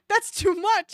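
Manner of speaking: high, loud horrified cry; horrified outburst. Acted. Narrator cries out in horror at the cheese. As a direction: recoil at the cheese pile. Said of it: "I hissed in horror".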